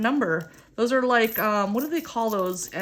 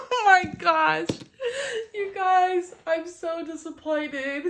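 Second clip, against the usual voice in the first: high, teary voice squeezed by laughter; real helpless laughter breaking up the words; laughing to tears; 9 semitones higher than usual. Real, not acted. Player telling viewers she lost, laughing to tears. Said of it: "my eyes are literally crying".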